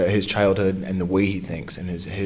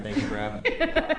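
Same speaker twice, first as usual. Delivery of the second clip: a lightly done presidential impression, much higher, through a laugh; President Obama voice; 4 dB quieter than usual. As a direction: toss off a playful hint of the president. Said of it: "this guy was still sort of doing the imitation".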